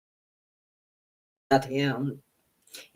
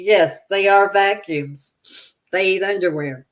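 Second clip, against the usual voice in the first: usual speaking voice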